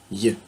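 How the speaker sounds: Y said as a short "ye" glide; French Y sound. Shown as a demonstration. Teacher showing how German J sounds, Y as "ye". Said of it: "il faut le prononcer comme un Y".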